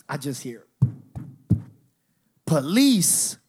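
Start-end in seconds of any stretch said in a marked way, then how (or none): 2.4-3.4 police call-out. Retold or imitated. Voice heard announcing police at party.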